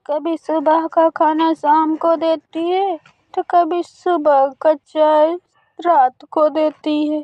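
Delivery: slow, grumbling auntie voice; complaining old bird. Acted. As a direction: drag out the complaints slowly. (धीमी शिकायती आवाज़)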